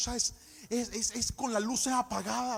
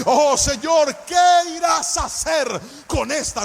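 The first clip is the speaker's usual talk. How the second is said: loud preaching over music, building up; impassioned sermon delivery; full-force preaching; 11 dB louder than usual. Acted. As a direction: preach it to the congregation with rising fervor.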